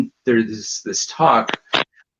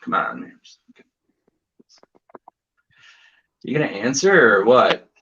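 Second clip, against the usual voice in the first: impatient mutter. Retold or imitated. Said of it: "me going like".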